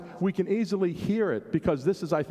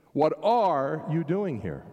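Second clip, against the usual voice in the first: ARE drawn out and punched; stress on ARE; slower than usual. Shown as a demonstration. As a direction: slowly lean on the word "are". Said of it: "put the emphasis on a different place".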